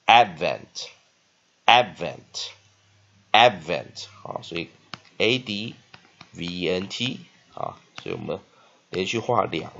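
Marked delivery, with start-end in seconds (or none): 0.0-4.1 "Advent" slow, both AD and VENT stressed; drawn-out, loud "Advent", weight on each syllable; double stress. Shown as a demonstration. Teacher drilling a two-stress word, "Advent" slowly with both syllables stressed. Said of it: "两个音节却有双重音".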